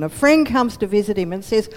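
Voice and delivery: usual speaking voice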